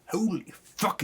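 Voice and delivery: usual speaking voice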